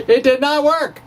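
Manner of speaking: high, loud comic letdown; mock-dramatic verdict. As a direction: deliver the bad news with comic drama. Retelling friend's verdict, laughing.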